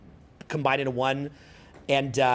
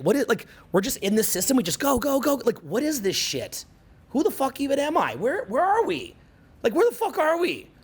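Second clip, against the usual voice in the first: much higher, incredulous questioning; bewildered panic; 9 semitones higher than usual. Retold or imitated. Alone in nature at forty: much higher, incredulous questions. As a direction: spiral into disbelief at it all. Retold existential crisis at forty.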